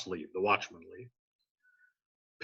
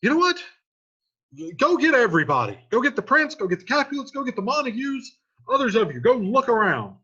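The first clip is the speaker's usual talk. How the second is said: frantic orders; 6 semitones higher, 4 dB louder than usual. Retold or imitated. Teacher voices watchman's frantic orders.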